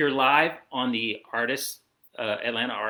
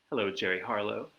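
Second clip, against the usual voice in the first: plain reading voice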